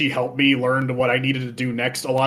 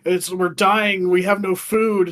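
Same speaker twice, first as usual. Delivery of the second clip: higher, slow whining complaint; whiny starving Goron; 5 semitones higher, slower than usual. Retold or imitated. Gorons begging Link about the blocked quarry, slow whiny higher voice. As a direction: moan like a dramatic starving giant. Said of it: "it's like".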